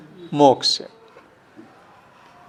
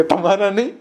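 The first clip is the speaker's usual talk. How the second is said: reply through a laugh; 7 semitones higher, 4 dB louder than usual. Retold or imitated. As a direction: chuckle while voicing the neighbor. Neighbor's teasing reply, retold.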